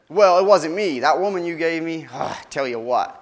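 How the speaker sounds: defensive blaming. Acted. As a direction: shove the blame onto the woman. Adam blaming in a quarrel.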